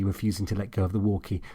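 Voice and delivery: ordinary delivery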